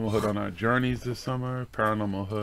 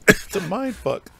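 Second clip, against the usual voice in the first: laughing; 11 semitones higher, 7 dB louder, slower than usual. Real, not acted. Laughing at the story.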